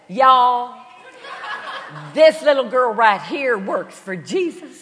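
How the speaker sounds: loud, proud Texan announcement; Southern Texan accent. Retold or imitated. Texan woman announcing seatmate to New York men, loud Southern accent. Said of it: "this wonderful Southern".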